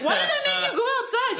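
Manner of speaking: high, loud mock-exasperated question; mock outcry. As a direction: cry it out in comic protest. Acted retort in a retold exchange.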